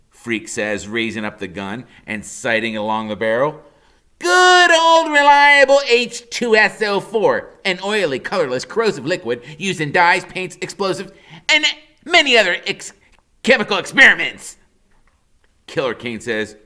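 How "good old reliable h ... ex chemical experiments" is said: high, loud, know-it-all recital; mock textbook kid. A narrator's reading. Freak reciting acid facts to scare Kane. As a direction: rattle off the facts like a smug encyclopedia.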